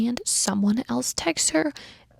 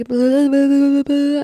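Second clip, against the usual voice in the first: mocking blah; 4 semitones higher, 8 dB louder than usual. Dismissing a text's apology.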